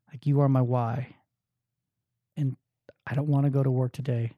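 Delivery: soft, low and tender, a little choked; tender words to wife. Acted. Husband quietly telling his wife she is his why, softly. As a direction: speak it gently like a private promise to her. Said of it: "I can even get emotional thinking about it".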